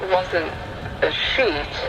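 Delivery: ordinary delivery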